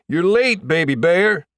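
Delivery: loud, high-pitched teasing holler; teammate calling out. A narrator's reading. Teammate O'Doul heckles the latecomer, loud teasing holler. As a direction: call it across a noisy room, high and loud.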